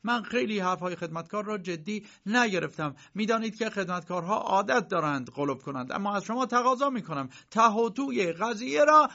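high, earnest letter voice; reading a daughter's letter. Acted. Uncle reading his daughter Erna's worried letter, high and earnest. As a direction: read her worried words aloud.

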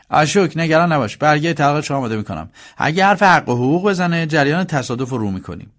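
high, loud, pushy brother's voice; scheming elder brother. Acted. Mukesh urging his brother toward divorce, insistent. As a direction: take charge and push the plan briskly.

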